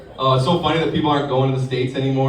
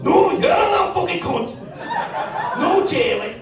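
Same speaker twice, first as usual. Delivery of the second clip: shouting Scottish mother. Retold or imitated. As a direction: scold the girl in a Scottish yell.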